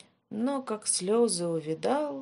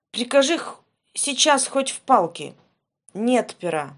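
loud protest; 4 semitones higher, 8 dB louder than usual. Acted. Ivan denies to the tsar.

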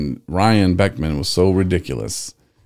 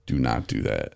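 stern therapist; 8 semitones lower, 8 dB quieter than usual. Retold or imitated. Therapist voiced, stern warning.

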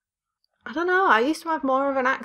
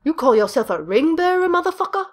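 mock outrage; 7 semitones higher than usual.

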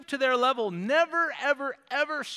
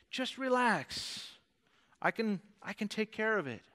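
soft, unhurried voice of God soothing; quietly reassure, as God in full control; calm reassurance; 6 dB quieter than usual. Acted. Voicing God calming the impatient believer.

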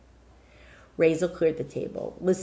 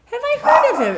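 eager question; 11 dB louder, faster than usual. Acted. Rabbi's son curious.

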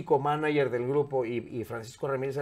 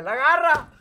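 blurted nickname; 11 semitones higher, 9 dB louder, slower than usual. Retold or imitated.

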